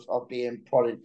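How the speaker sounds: ordinary delivery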